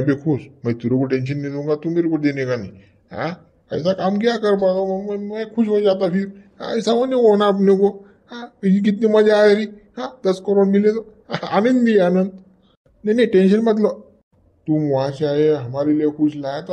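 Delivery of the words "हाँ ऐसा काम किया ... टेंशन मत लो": higher, louder, giddy with the bribe money; gleeful boss. Acted. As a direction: crow with delight over the cash. Boss gloating over ten crore bribe. (खुशी से चहकता, ऊँची और तेज़ आवाज़)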